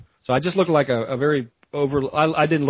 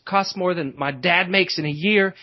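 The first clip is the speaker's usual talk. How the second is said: usual speaking voice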